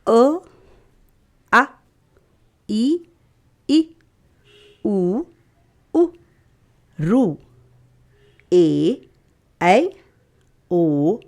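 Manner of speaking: each vowel said alone, slowly with long pauses; reciting vowels. Shown as a demonstration. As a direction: recite the vowels one by one, like a teacher to small kids. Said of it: "स्वर से शुरुआत करेंगे".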